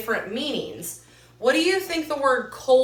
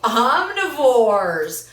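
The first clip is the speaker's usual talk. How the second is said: very drawn out, louder; slow emphatic echo; 6 dB louder, much slower than usual. Shown as a demonstration. Teacher confirming a pupil's answer, slow and emphatic. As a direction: stretch the word for the class.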